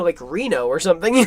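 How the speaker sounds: ordinary talk, nothing marked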